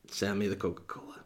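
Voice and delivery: breathy, unvoiced throwaway whisper; a whispered, defeated request; whispered aside. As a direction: mutter the order under your breath, giving up. Resigned inner thought, whispered aside.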